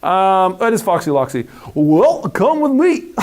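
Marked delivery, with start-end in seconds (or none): 1.7-3.1 a hint of a goofy fox. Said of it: "Sounds like Goofy Loxy".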